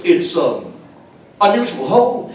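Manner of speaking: halting Kirk-style pauses, jumping up on unusual; Captain Kirk impression. Shatner slipping into Captain Kirk about the neighbor's house. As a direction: ham up the Shatner Kirk cadence. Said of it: "he turned into Captain Kirk".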